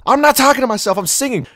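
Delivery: high, loud acted denial; indignant protest. Singer answering a friend who says they talk to themselves, indignant.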